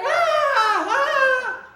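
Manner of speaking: high imitated cries of the lady in the movie; hammed-up film scream; mock scream. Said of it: "she's screaming".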